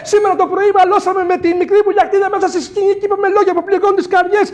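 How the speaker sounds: high, loud, fast desperate begging; frantic pleading. Acted. Young man begs the old creator for help, frantic and high. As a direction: pour it out in a panicked rush.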